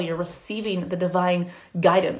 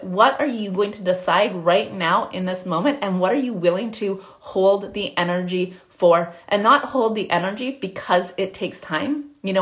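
normal talking voice

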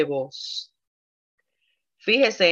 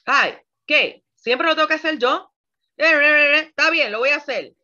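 grudging complaint; 6 semitones higher, 4 dB louder than usual. Pretend. Acts a grudging wife complaining.

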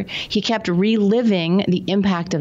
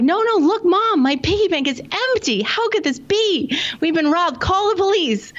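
high-pitched acted panic of a little boy; frantic child; 12 semitones higher than usual. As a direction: plead with mom in mock alarm, pitched way up. Acted child frantic about robbery.

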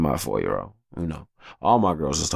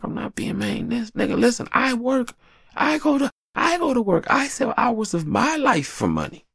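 high exasperated lecture. Retold or imitated. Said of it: "I'm like".

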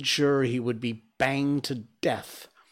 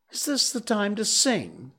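angry; 9 semitones higher than usual. Acted. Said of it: "said Rikki Tikki angrily".

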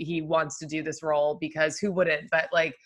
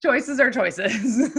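laughing mock wisdom; 5 semitones higher, 3 dB louder, much slower than usual. Mock-wise laughing verdict.

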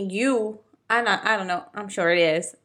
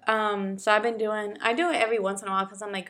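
ordinary delivery

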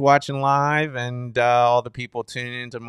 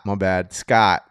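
usual speaking voice

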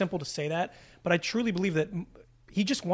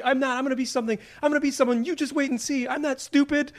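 higher, louder mock of clumsy lines; dumb dialogue imitation; 7 semitones higher, 3 dB louder than usual. Podcaster imitates the son's clumsy shouted dialogue. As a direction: ham up the son's dumb defiance. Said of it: "If you saw it on page".

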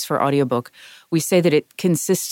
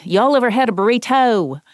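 mimicking a Southern cousin, higher and a bit louder; cousin's Southern accent, pitched up; 7 semitones higher, 3 dB louder than usual. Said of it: "one of my cousins once said".

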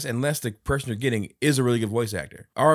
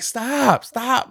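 loud high stop stop, half laughing; high shouted stop; 7 dB louder than usual. Retold or imitated. Uncle imitating the niece shouting stop at him. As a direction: shout it like an angry little kid. Child quote.

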